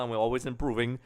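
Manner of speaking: ordinary delivery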